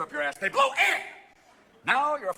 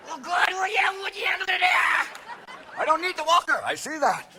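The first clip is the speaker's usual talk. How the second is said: put-on old man voice; 7 semitones higher than usual. Grandpa voice.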